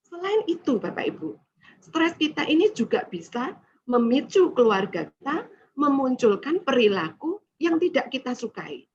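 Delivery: ordinary delivery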